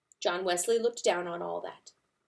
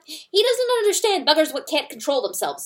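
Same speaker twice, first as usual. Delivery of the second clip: loud, higher angry rant; angry weasel; 7 semitones higher, 7 dB louder than usual. A narrator's reading. Weasel angrily scolding the dead fox, loud and high. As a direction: rant at the corpse. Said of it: "It sounded mostly angry".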